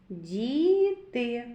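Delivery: "Діти" slowly drawn out, vowels held; sound-by-sound. Shown as a demonstration. Teacher sounds out a word for children, slow and stretched "Діти". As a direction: stretch both syllables of "Діти". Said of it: "Зробимо звуковий аналіз слова діти".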